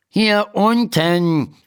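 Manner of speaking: high, drawn-out annoyed call. A narrator's reading. Unseen little man tells Emma where to look, annoyed. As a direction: stretch it out slowly in a high, irritated voice. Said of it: "sagt die Stimme verärgert".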